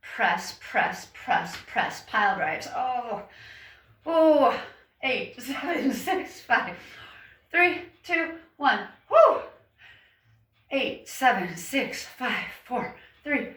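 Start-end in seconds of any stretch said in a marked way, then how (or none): 4.0-4.6 loud high oh of effort; straining groan. Real, not acted. Straining in deep squat. 9.1-9.4 loud high woo; excited whoop. Real, not acted. Cheering after a set.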